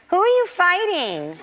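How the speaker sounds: put-on high, slow little-girl question; imitating young daughter. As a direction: ask it like a wide-eyed small child. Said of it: "And she was like".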